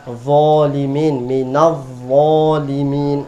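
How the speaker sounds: "Zolimin, minaz zolimin" drawn out slowly with a thick "zo"; slow, thick recitation. Shown as a demonstration. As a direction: recite it slowly like a model for the class. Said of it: "maka dia akan berbunyi tebal". (dibaca pelan dan tebal)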